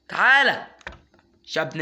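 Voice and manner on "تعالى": higher, louder urgent summons; urgent call. Real, not acted. Gamer calling his pet to come, urgent loud call. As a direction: call the pet over fast.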